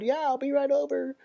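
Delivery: very high, chirpy mock friend; sing-song it like an eager buddy; cheery friend voice. Friend cheerfully promising to come over, high chirpy voice. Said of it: "Like, a friend or something".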